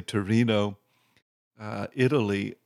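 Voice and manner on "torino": Torino said the Italian way, tor-EE-no; Italian name. Shown as a demonstration. Naming the city Turin as locals say it, Torino in Italian. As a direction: roll into Torino with an Italian ring. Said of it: "here we would say".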